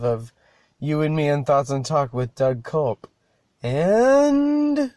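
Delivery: slowed, drawn-out announcer sign-off; lightly hosted show closer with a sung-up "and"; podcast outro. Acted. Host closing the episode, mild announcer voice.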